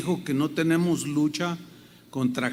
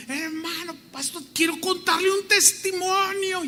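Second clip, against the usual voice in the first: very high, piercing woman's voice; gritos de señora; 12 semitones higher than usual. Retold or imitated. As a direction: mimic an excited woman shrieking at her pastor. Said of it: "pero con unos gritos". (imitando los gritos agudos de una señora)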